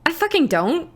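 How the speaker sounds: high-pitched retold protest; indignant denial. Speaker denying a friend's accent claim, indignant.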